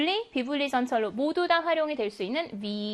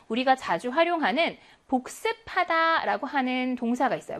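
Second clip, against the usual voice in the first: usual speaking voice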